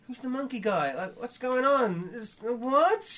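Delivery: high, whiny, slow confusion; whiny baby voice. Retold or imitated. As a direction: whine like a baffled little kid. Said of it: "You sound like a whiny little baby".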